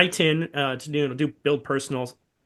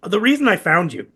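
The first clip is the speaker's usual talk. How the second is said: losing voice; 3 semitones higher, 6 dB louder than usual. Real, not acted. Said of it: "I'm losing my voice".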